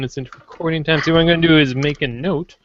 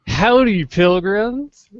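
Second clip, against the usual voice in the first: hearty cowboy howdy, higher and louder; cowboy greeting; 5 semitones higher, 4 dB louder, much slower than usual. Pretend. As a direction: greet them like a frontier cowboy. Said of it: "no, wait, that's not my intro".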